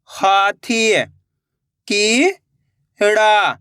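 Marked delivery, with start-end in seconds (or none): 1.9-3.5 very slow, syllables pulled apart; slow syllable reading. Shown as a demonstration. Teacher showing pupils how to sound out syllables slowly. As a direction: sound out each piece slowly. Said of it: "तो बताइए मिलके क्या बनेगा?".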